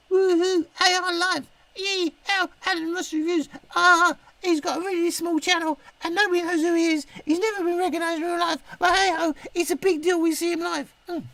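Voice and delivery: high mocking falsetto of a fan gushing over the channel; mock fan squeal.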